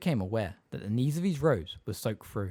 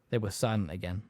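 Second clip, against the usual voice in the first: ordinary delivery, nothing marked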